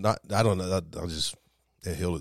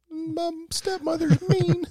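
high, slow princess whine; whiny princess; slower than usual. Pretend.

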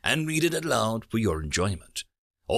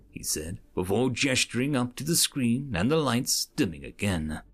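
ordinary delivery

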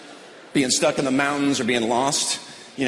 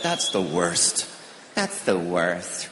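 low, slow drawn-out moan of that's the worst; whiny that's the worst; 8 semitones lower, 5 dB quieter, much slower than usual. Retold or imitated. People whining over small things, slow and low. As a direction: drag it out like a sulky complaint. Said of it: "You ever heard people say that?".

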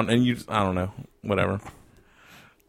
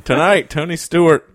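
promo voice building high and loud; hammed-up TV sports teaser, slowing on the punchline; mock sports announcer; 7 semitones higher, 6 dB louder than usual.

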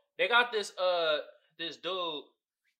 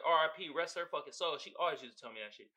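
usual speaking voice

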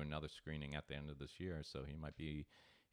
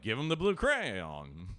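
slow, louder, overly careful voice; mock teacher; 5 semitones higher, 9 dB louder, slower than usual. Teachers humouring the kid with a slow, careful voice. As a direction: mimic the patient adult humouring him. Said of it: "They were like".

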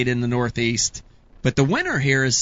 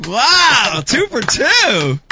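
high, loud, stretched-out cheering; delighted celebration; 8 semitones higher, 6 dB louder, much slower than usual. Real, not acted. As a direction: crow over the winning streak. Host celebrates second correct guess.

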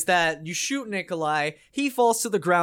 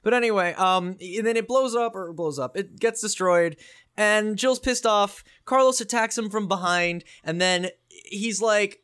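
normal talking voice

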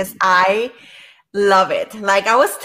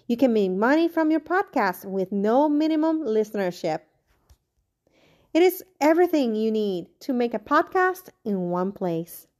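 an even, slightly subdued sponsor read; podcast sponsor spot. Acted. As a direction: read the ad copy smoothly. Host reads sponsor ad.

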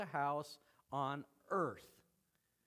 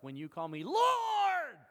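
much higher, loud and slow; emphatic call; 13 semitones higher, 10 dB louder than usual. Retold or imitated. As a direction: cry out "Lord" with heavy emphasis. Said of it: "okay, emphasis".

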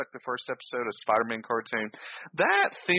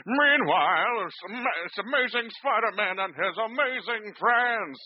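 high, slow cartoon announcer voice; 11 semitones higher, slower than usual. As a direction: announce it like a Saturday cartoon intro.

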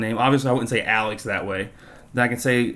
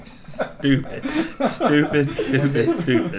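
laughing; 3 semitones higher, much slower than usual. Real, not acted. Laughing at darts joke.

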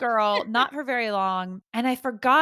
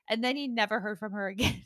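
ordinary talk, nothing marked

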